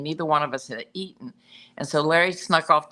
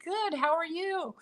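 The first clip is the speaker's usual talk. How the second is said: tiny, very high, soft squeak; starstruck; 13 semitones higher, 6 dB quieter than usual. Retold or imitated. Starstruck fan answering John Carpenter, squeaky and small. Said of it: "I was, like, so starstruck".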